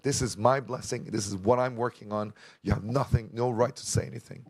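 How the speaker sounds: ordinary talk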